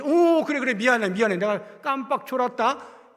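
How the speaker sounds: high, loud, flustered sorry; how Jesus did not answer, hammed up; mock apology. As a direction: play a sheepish Jesus caught napping. Imagined sheepish apology.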